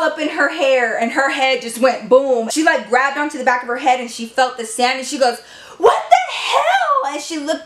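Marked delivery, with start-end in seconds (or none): 5.8-7.1 put-on much higher, slow, startled outraged girl; shrill shocked girl. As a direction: squeal it like a stunned prissy girl. Lisa hit by sand.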